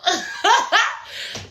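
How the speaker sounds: high burst of laughter; amused laugh. Real, not acted. Amused by her castmate's accent, high laugh. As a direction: break into a high-pitched cackling laugh.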